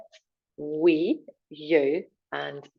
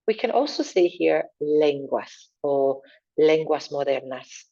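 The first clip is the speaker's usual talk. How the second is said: ordinary talk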